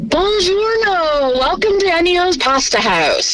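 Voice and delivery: put-on slow, drawn-out Italian accent. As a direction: greet like an Italian host in an Italian accent. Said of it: "Give us your best Ennio's Pasta House in an Italian accent".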